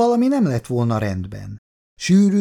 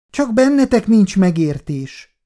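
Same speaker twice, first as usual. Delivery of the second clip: annoyed; 6 semitones higher than usual. A narrator's reading. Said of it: "bosszankodott Szabó néni".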